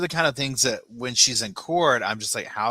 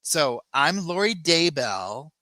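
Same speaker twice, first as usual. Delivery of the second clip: slow, higher, self-important mock; mock Lori; 7 semitones higher, 4 dB louder, slower than usual. Lori boasting to Keith, slow, higher mock. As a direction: draw out the name grandly. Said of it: "she's like".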